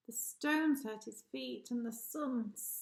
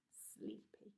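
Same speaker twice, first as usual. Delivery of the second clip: sleepy whisper; 18 dB quieter than usual. A narrator's reading. Narrator reads sleepy sloth.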